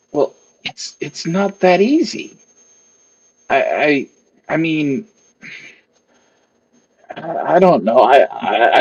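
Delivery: stammering, halting nervous tinkerer; flustered maker. Acted. Maker hedging to the cloaked stranger, flustered and stammering. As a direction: hedge and restart, tripping over the words.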